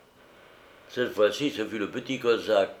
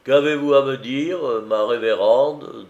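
louder, higher, swaggering question; cocky merchant; 4 semitones higher, 8 dB louder than usual. A narrator's reading. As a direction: address the maid with mock respect. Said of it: "d'un geste faraud".

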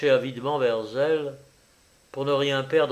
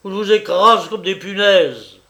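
loud, high menace; butcher's roused, rough threat; hoarse threat; 6 semitones higher, 9 dB louder than usual. A narrator's reading. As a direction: threaten to crush them, loudly. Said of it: "de sa voix enrouée".

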